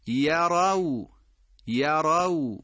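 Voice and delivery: یرو with a clear open first vowel; careful recitation; correct open vowel. Shown as a demonstration. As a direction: say یرو slowly, not rounded. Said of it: "باز هم همون نکته".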